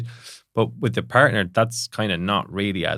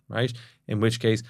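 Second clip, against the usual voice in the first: ordinary talk, nothing marked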